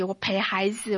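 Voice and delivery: ordinary talk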